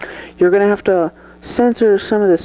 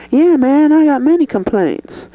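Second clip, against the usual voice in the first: eager outburst; 7 semitones higher than usual. Acted. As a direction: jump at the chance to gripe. Guest eager to complain.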